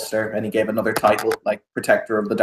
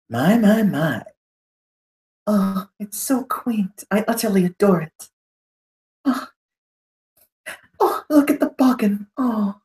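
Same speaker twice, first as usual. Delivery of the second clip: haughty noble; 10 semitones higher than usual. Acted. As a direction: coo over the room like a delighted snob. Noble voices his character.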